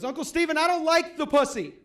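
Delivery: put-on high-pitched little kid voice, laughing through it; toddler voice. Two-year-old nephew tells his uncle, high toddler voice. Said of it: "and he goes".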